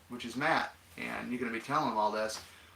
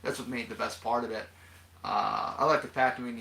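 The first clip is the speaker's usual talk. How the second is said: ordinary talk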